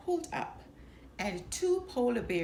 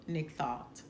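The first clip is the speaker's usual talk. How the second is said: usual speaking voice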